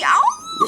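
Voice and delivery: a sharp outraged yelp way up high; shocked high cry. Acted. Wife outraged as her ex looks over, high yelp.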